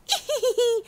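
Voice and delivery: high teasing little laugh; cheeky high giggle. A narrator's reading. Gingerbread cowboy laughing at the rancher, high giggle. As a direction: giggle up high like a naughty cookie. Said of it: "se rió y dijo".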